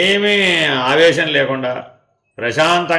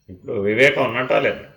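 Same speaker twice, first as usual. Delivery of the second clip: usual speaking voice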